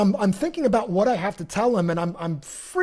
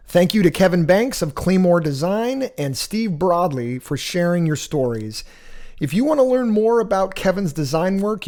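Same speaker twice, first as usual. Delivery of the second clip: podcast outro read; 3 semitones lower, 5 dB louder than usual. Acted. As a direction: read the credits and links like a sponsor spot.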